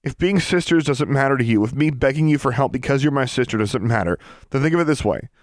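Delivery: high, loud, impassioned begging; desperate pleading. Acted. Girl begging her estranged sister to save a friend, desperate pleading. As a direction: plead urgently, pitched high and pushed hard.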